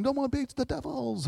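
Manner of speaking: high, eerie floating demon whisper; mock demon voice. Preacher mocking what demons say, hammed-up demon. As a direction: ham up a spooky demon. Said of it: "demons just float around saying".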